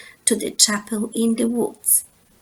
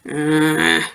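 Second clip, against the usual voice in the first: low, lazy grunt; Papa grunting behind his paper; sleepy grunt; 7 semitones lower than usual. Acted. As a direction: grunt without looking up. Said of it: "grunted Papa".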